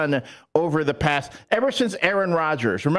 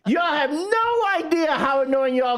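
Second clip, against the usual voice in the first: indignant rant; 7 semitones higher than usual. Real, not acted. Ranting back at Packers fans.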